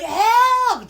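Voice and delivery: shouted high cry for help; loud pretend scream for help; mock cry for help. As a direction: yell Help! as if trapped. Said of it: "So you can't be like".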